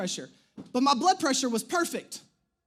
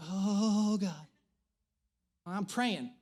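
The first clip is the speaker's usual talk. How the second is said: mock dread; 5 semitones lower, 5 dB quieter, much slower than usual. Dreads wife's coming question.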